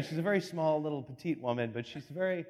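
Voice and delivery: ordinary talk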